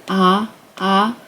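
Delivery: "ah" as an open, isolated vowel; demonstrated vowel. Shown as a demonstration. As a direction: repeat "ah" as a teacher's vowel model. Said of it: "like, a ah sound".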